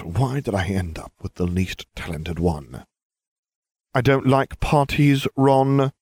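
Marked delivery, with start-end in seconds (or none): 3.9-5.9 1 forced patience. A narrator's reading. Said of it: "with forced patience".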